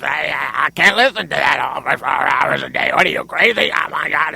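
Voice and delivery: low, rushed garbled growl; mock his voice in a low, fast babble; mocking his radio voice. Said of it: "How do you talk like this?".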